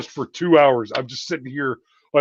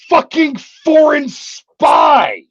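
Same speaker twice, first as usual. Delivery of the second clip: slow, high yelled punchline; emphatic shout; 11 semitones higher, 6 dB louder, much slower than usual. Acted. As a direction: bellow it out slowly, word by word. Emphatic punchline about Chinese spy.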